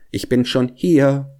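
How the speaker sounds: high call, last word held. A narrator's reading. Hedgehog or wife greets the hare each time, calling out. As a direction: call out the refrain. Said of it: "rief ihm der Igel entgegen".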